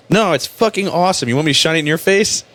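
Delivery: high-pitched, mock-enthusiastic comeback; testy sarcasm. Driver answering whether the cop's light bothers him, testy sarcasm. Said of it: "I get testy with cops".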